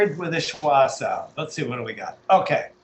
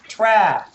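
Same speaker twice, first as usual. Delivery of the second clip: "Tra" louder and held; stressed syllable; 2 semitones higher, 5 dB louder than usual. Shown as a demonstration. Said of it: "The stress is on".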